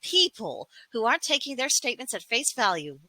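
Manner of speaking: mocking whine. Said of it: "throwing absolute hissy fits".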